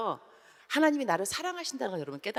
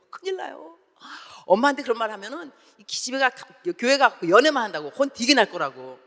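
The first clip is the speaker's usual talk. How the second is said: put-on alarmed teen girl; 5 semitones higher, 7 dB louder than usual. Girl alarmed at telling mom.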